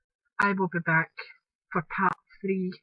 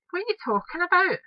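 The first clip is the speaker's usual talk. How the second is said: high, incredulous retold question; baffled reply; 12 semitones higher than usual. Telling colleague she's confused, incredulous. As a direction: act properly thrown by it.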